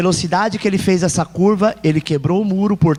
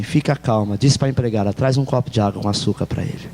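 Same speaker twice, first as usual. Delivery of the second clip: calm reassurance; 10 semitones lower than usual. Acted. Gracious man calms the boy.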